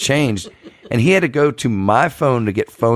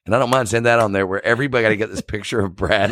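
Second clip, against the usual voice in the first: ordinary delivery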